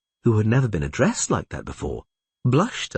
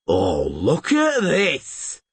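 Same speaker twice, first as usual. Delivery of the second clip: shouted; 5 semitones higher, much slower than usual. A narrator's reading. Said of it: "shouted the pockmarked man".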